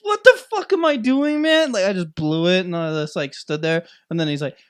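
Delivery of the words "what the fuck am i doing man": high, loud self-reproach; mock panic. Fan who just blew it, mock panic. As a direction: wail it at yourself in mock despair. Retold inner panic after blunder.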